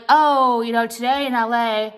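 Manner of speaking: loud, lifted mock newscaster; news presenter. Vlogger mimicking local TV news, mock presenter voice. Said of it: "on the news channel".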